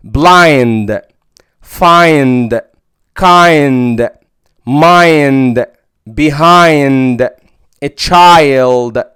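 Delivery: blind, find, kind, mind said with ai; teacher demo; long i before nd. Shown as a demonstration. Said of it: "الاي الف وياء".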